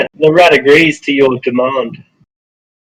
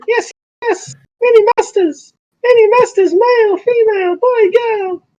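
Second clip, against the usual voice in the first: very high squeaky rat voice; rat king. Acted. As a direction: chitter like a rat creature. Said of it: "I speak in a rat voice".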